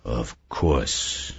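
soft, low echo, drawn inward; softly repeated. A narrator's reading. Saul grasps his partner's revelation, softly. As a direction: let it sink in as you repeat it low. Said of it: "Saul repeated softly".

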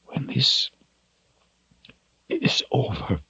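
soft, high, choked with emotion; halting, voice cracking on the words; voice breaking. A narrator's reading. Shota speaks without turning back, voice breaking. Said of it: "in a voice that broke with emotion".

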